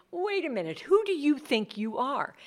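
mock outrage, pitched high; incredulous challenge. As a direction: Pharisee demanding who he thinks he is. Pharisees challenging Jesus.

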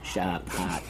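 sharp and hot in a Boston accent, dropped r. Shown as a demonstration. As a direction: say sharp like "shahp" Boston-style. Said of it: "said with, like, a Boston-style accent".

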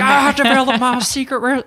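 high, very loud indignant yell; mock tantrum. Founder raging over his secret recipe, hammed-up fit. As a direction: explode like an outraged old founder. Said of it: "slam it on the ground and have a fit".

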